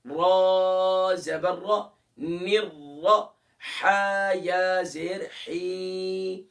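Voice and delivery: slow, loud syllable-by-syllable drill; slow, emphatic letter-by-letter drilling; spelling out syllables. Shown as a demonstration. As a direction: spell each syllable out slowly, like a teacher. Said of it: "उसको दो मरतबा पढ़ेंगे".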